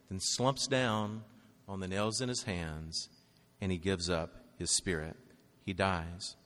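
low, soft, slowing delivery; hushed and solemn. Real, not acted. Preacher telling congregation of Jesus' death, hushed. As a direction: sink quietly, with pauses between phrases.

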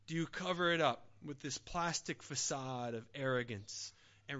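quiet intimate preaching. Acted. Preacher softly questions the congregation about prayer, hushed.

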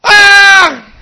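put-on a loud, high scream of a lost little boy; panicked child's scream. Preacher reliving being lost on the beach, screaming loud and high. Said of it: "I was like".

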